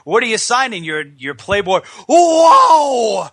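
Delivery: high voice erupting into a loud whoa; mock wrestler holler, shocked midway; Ric Flair impression. Host imitating wrestler seeing naked pictures, high shocked shouting. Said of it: "So he goes".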